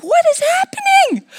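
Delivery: very high, strained yelp; a shrill-pitched mix of panic and laughter; panicked cry. Retold or imitated. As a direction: cry out, shocked by the pain. Doubling over in pain.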